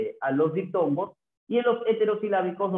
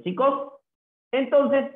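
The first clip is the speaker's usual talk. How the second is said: calling out; 10 semitones higher, 5 dB louder, slower than usual. Real, not acted. Teacher calls class to attention.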